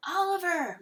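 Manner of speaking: high call after a runaway pet; urgent, high-pitched shout. A narrator's reading. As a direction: call the name high, falling away. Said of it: "Mister Sims called out".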